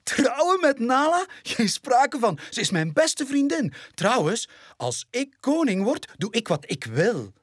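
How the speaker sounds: veel hoger, eigenwijze welpenstem; cheeky lion cub. Acted. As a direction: speel een brutaal jong leeuwtje. (welp wijst het huwelijk af, hoog en eigenwijs)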